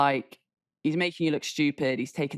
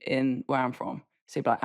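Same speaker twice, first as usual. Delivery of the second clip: normal talking voice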